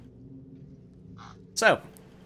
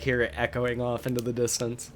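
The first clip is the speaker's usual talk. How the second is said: ordinary talk, nothing marked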